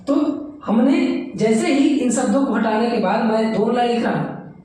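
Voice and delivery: ordinary delivery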